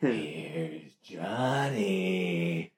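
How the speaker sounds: low, very slow menacing drawl; lightly voiced psycho killer; creepy movie quote.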